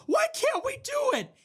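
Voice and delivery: very high, squealed mock wail; hammed-up helpless whine; mock whiny complainer. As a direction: wail it in a mocking falsetto.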